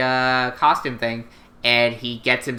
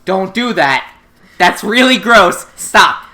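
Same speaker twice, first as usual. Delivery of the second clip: higher, louder, slow disgusted protest; mock disgust; 6 semitones higher, 7 dB louder, slower than usual. Council refusing the kiss prize, loud disgusted protest. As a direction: recoil and protest, grossed out. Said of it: "student council is like".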